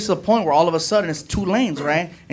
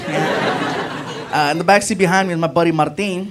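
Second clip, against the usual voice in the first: usual speaking voice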